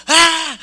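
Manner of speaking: high mock demon scream; possessed yell. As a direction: cry out as if the devil got in. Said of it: "como que le entró el demonio". (grito endemoniado, imitado)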